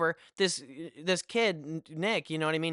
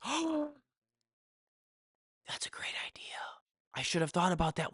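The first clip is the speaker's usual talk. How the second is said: high soft "oh" sinking into a whisper; awed whisper; 3 semitones higher, 6 dB quieter than usual. Retold or imitated. Storyteller reacting to mom's milk suggestion, awed hushed whisper. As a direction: whisper it as a sudden revelation.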